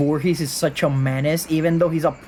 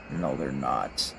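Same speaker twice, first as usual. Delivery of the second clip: deep growl; 11 dB quieter, slower than usual. Real, not acted. Answers own question in low voice.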